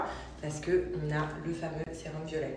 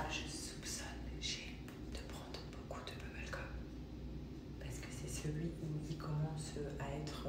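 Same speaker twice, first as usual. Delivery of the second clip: whispering; 8 dB quieter than usual. Real, not acted. Whispering, baby asleep.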